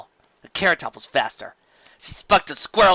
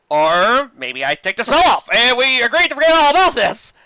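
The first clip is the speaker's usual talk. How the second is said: nervous bargain; 10 semitones higher, 10 dB louder than usual. Acted. Flam bargains nervously with Carrot Top. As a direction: wheedle your way out.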